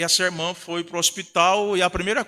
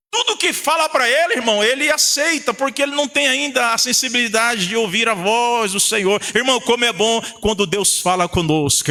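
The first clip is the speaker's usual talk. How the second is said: higher-pitched, driving preacher's delivery; pregação fervorosa; 5 semitones higher than usual. Real, not acted. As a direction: preach it with mounting fervour to the congregation. Preaching at full force. (pregação inflamada)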